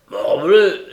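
loud, high burst of exasperation; exclaimed oath. Acted. Brigand wipes off sweat after the struggle, exclaiming. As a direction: let out a forceful curse.

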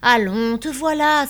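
loud, high protest; crying out. A narrator's reading. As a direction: burst out in exasperation. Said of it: "s'écria Birotteau".

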